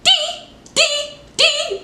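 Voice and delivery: very high eerie squeal; high shrill horror-movie screech; imitating Carrie's crazy face. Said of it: "She's like".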